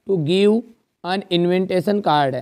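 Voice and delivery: slow dictation. Shown as a demonstration. Dictating a model letter slowly.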